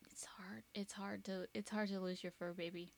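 quiet, softened grief, dropping to near whisper; real, subdued heartache; holding back tears. Real, not acted. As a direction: speak softly through real sadness, trying not to cry. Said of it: "I'm trying not to cry again".

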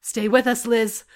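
firm teammate's order; firm, hard. A narrator's reading. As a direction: hold the wounded steady, firmly. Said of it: "The voice was firm, but hard".

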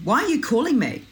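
high-pitched mock teenage complaint; exaggerated put-out teen voice; indignant teen. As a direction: imitate an affronted daughter objecting. Acts out daughter answering a phone call.

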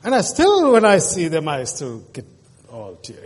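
breaking up as he remembers; emotional catch in the voice; choked up. Real, not acted. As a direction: voice catching on tears. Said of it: "I was a sookie".

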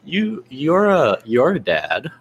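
usual speaking voice

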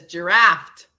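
"giraffe" ending in a hard T; imitated "giraffe" with an added final T. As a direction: say "giraffe" as jur-AFT. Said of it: "With a T".

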